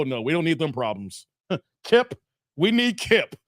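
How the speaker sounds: usual speaking voice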